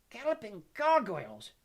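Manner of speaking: high, loud, slow exclamation; shocked shout. A narrator's reading. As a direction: shout it in astonished outrage. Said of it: "shouted Professor Tofty".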